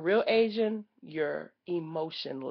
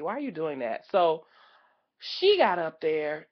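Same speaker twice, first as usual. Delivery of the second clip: ordinary talk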